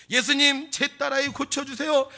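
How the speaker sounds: high, loud acted plea; pleading cry. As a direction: cry out to Jesus for your daughter. Woman bows and begs Jesus. (높고 크게 외치는 간청)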